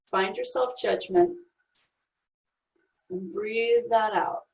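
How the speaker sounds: calm, soft guiding voice, unhurried; guided meditation. Acted. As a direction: lead them gently inward.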